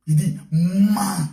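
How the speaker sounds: slow, weighty repeat of the order; slow emphatic. Acted. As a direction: stretch each word like a solemn verdict.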